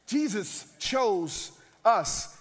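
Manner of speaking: words stretched very slow; drawn-out solemn line; slow declaration. Real, not acted. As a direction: let it sink in. Climactic statement after wife story.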